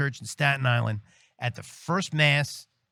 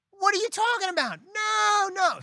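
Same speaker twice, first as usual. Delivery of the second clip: screaming at him. Retold or imitated. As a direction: scream it at him, refusing to hear it. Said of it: "she starts screaming at me".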